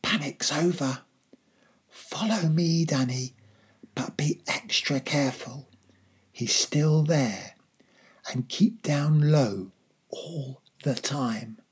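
careful, breathy whisper. A narrator's reading. Father leading son away from the keeper, cautious whisper. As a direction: whisper instructions in short, separate phrases. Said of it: "I heard my father whisper".

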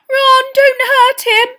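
slow, very high, loud squeal. A narrator's reading. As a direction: squeal out a desperate plea. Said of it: "squealed Hermione".

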